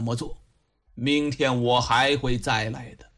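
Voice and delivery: low, measured magistrate voice; grave, patient elder counselling; patient old official. A narrator's reading. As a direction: urge reflection in a deep, even voice.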